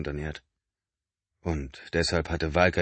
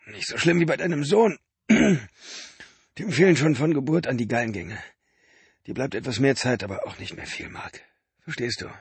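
a lightly voiced sick man, pressing his brother; straining urgency, a touch higher; Benny, weak and urgent; 8 semitones higher, 4 dB louder than usual. A narrator's reading. Benny pressing Mark about the liver, lightly voiced urgency.

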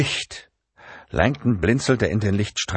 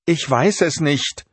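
frightened denial; 10 semitones higher, slower than usual. A narrator's reading. Catherine voiced, frightened.

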